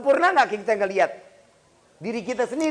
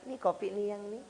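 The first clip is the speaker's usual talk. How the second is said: soft, quieter acted wife; sweet wifely voice; 9 dB quieter than usual. Wife bringing coffee to her husband, sweet and soft. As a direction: serve it softly, as a doting wife would.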